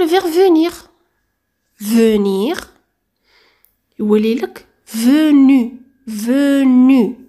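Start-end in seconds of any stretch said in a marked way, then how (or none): 4.0-7.2 فونو stretched slow, the final u held long; drawn-out venu. Shown as a demonstration. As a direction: lean on the ending of فونو, slowly. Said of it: "او à la fin". (مدّ آخر فونو ببطء)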